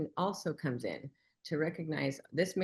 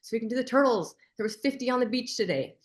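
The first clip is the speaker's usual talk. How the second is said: imitating an excited older lady, higher, louder and fast; 6 semitones higher, 6 dB louder, faster than usual. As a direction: rush out the invitation with delight. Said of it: "And she just said".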